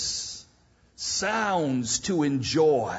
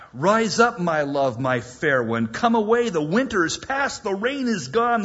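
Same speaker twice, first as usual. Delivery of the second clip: louder, soaring call to rise; beloved calling; 5 semitones higher, 6 dB louder than usual. Acted. Preacher voicing the beloved calling the bride: loud summons. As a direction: lift the voice like a bold summons. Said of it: "My beloved spake and said".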